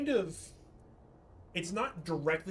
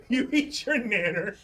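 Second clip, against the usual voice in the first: put-on teasing baby talk; 5 semitones higher, 8 dB louder than usual.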